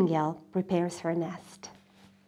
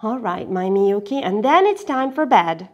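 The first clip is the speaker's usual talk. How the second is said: louder, lightly voiced grandfather; grandfather agreeing; 2 semitones higher, 7 dB louder than usual. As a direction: give in, then remind.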